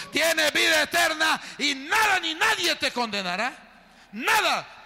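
high, loud shouted proclamation; exultant preaching. Real, not acted. Preacher assures the forgiven of eternal life, exultant shout. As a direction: cry it out to the whole church.

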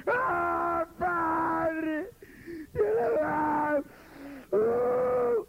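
mock high crying shout of "padre"; theatrical crying prayer. Pastor opening a worship congress, hammed-up wailing shout. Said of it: "¿Por qué le hablan así al Señor?".

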